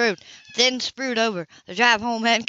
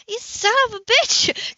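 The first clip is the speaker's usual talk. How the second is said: furious outburst; 12 semitones higher, slower than usual. A narrator's reading. Anna opens the door on Cam, furious. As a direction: spit it at him.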